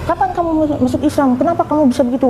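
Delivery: high-pitched imitation of an angry parent; retold scolding. Mother confronting her child about converting, retold angry scolding. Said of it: "saya dimarahin terus".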